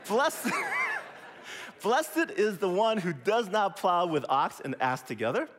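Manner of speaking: reading the verse through real laughter; can't keep a straight face at the ox and ass line; laughing through it. Real, not acted. Preacher laughing to the congregation as he reads the verse.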